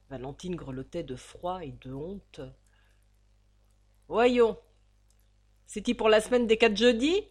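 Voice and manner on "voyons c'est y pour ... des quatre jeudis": much louder, coarse and mocking; loud old landlady. The landlady loudly demanding the rent. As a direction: bark at the girl for her rent.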